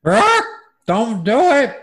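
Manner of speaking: squawky high parrot. Acted. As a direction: screech it like a parrot. Voicing the parrot.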